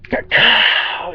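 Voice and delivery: high, explosive "ka-cow"; imitated gunshot. Telling of firing the rifle, imitated gunshot. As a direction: fire off a mouth-made rifle shot. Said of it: "And I went".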